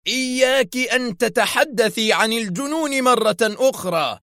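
very high, strained furious warning; ferocious, sustained threat; savage menace. A narrator's reading. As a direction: threaten fiercely, pitched right up. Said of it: "قائلا في شراسة وحشية".

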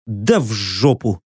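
low, slow, dismissive; gruff grumble. A narrator's reading. Odi brushing off the prisoner's plea, low grumble. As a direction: grumble it low under the breath. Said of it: "буркнул я".

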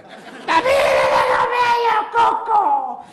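put-on little boy voice. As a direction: whine like a scared toddler.

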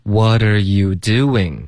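slow, drawn-out model reading; slow repeat-after-me drill; slow demo. Shown as a demonstration. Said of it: "我们再说几遍".

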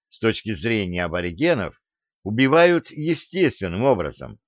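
ordinary delivery, nothing marked

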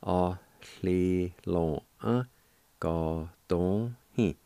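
"a, li, lo, uh, go, don, he" with each syllable separated; "a, li, lo, uh, go, don, he" with pauses between syllables. Shown as a demonstration. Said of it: "And together".

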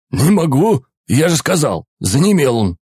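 high, tense and pleading; panicky guard. Acted. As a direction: voice climbing in panic. Guard panics, finger numb.

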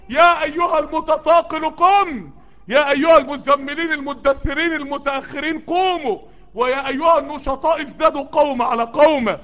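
rising, urgent sermon call; fervent preacher's exhortation; preaching at full force. Acted. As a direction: rouse the sluggish listeners.

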